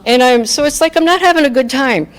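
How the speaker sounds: ordinary talk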